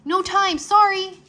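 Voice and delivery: high, loud hurried call. A narrator's reading. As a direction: shout it back while leaving. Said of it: "Drake called".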